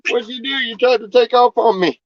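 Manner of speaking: high sing-song scolding of a runaway pig; high, teasing voice for a naughty animal; pet talk. Real, not acted. Owner teasing the escaped pig in a high pet voice.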